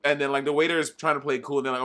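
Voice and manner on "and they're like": normal talking voice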